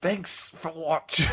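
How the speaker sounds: slow, high, put-on Irish "thanks for watching"; slow Irish attempt. Shown as a demonstration. Host trying a Leprechaun-style sign-off, slow, high, hammed-up Irish. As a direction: drag the Irish sign-off out slowly. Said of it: "me trying to do an accent".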